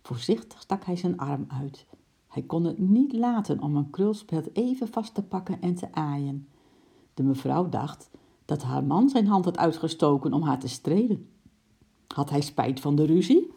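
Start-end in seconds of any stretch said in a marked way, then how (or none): none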